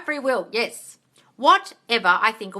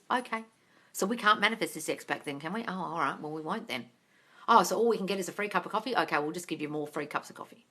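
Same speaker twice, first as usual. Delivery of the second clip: softer, resigned inner voice of the brain, a touch sulky; mock voice of the brain; 4 semitones lower, 7 dB quieter, faster than usual. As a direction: play her subconscious shrugging and giving up, quietly. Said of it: "and it goes".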